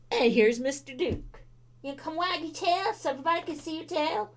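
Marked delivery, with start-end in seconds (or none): 1.8-4.3 pet talk. Real, not acted. Baby talk to the dog.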